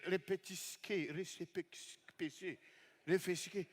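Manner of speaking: mumbled, very soft garbled répétisqué; low muttered répétisqué, mangled; fumbled word. Real, not acted. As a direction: stumble over répétisqué quietly. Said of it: "Répétez".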